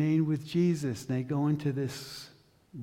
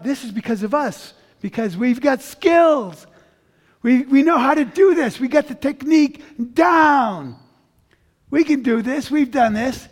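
much higher and louder, puffed-up cocky voice; boastful mock bravado; 10 semitones higher, 14 dB louder than usual. Preacher mocking the disciples' overconfidence, loud cocky bravado. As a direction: strut like an arrogant know-it-all.

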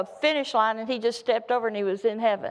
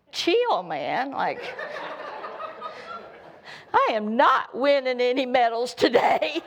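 put-on teenage complaint. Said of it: "your teenage mind is going".

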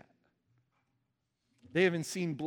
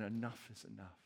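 lower, much softer weary sigh; weary cry; 6 semitones lower, 11 dB quieter than usual. Retold or imitated. Enslaved Israelites crying out to God, softly worn down. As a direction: let it fall out like an exhausted plea. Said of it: "crying out saying".

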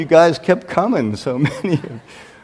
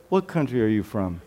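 soft, lowered, careful asking; gentle polite question; 7 semitones lower, 10 dB quieter than usual. Retold or imitated. Pastor trying not to embarrass a visitor, soft and careful. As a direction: ask delicately, keeping it low.

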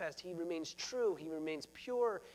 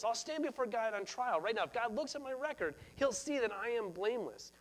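higher-pitched, insistent protest of innocence; imitated defensive outburst, pitched up; defensive cry; 4 semitones higher than usual. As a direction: act out Job crying out in self-defence. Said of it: "he cries out".

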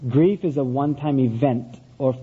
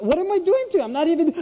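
high-pitched exasperated father; 12 semitones higher, faster than usual. Retold or imitated. Father to his sobbing son before the spanking, exasperated. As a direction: demand an explanation in disbelief.

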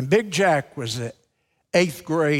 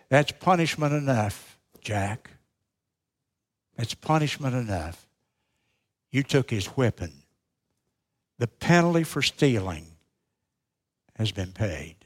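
teacher breaking down; 3 semitones lower, 3 dB quieter than usual. Retold or imitated. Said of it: "the teacher broke down".

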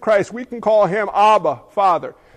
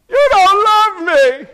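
put-on much higher, louder, slow child's wail; childish whine; 10 semitones higher, 8 dB louder, much slower than usual. Believer whining at God like a child, high and slow. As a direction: wail it like a hurt little kid.

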